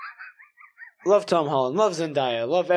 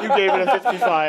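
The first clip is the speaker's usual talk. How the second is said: fast, louder, laughing through it; 3 semitones higher, 4 dB louder, faster than usual. Real, not acted. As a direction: crack up while repeating it.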